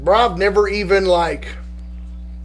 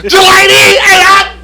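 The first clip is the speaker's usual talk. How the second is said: high, loud panicked cry; mock terrified scream; 12 semitones higher, 9 dB louder, slower than usual. Telling friends about the ride, mock terrified shriek. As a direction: shriek like the car is going too fast.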